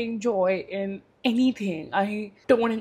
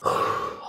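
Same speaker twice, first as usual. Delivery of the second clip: long unvoiced sigh after crying; shaky sigh. Real, not acted. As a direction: breathe out to steady yourself. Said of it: "I need to stop crying".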